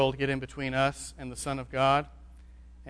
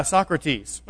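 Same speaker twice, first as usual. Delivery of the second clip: ordinary talk, nothing marked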